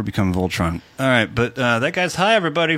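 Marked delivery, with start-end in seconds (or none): 1.8-2.8 called out higher to the audience; a playful announcement to the crowd; announcing. Real, not acted. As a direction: tell everybody, rising at the end.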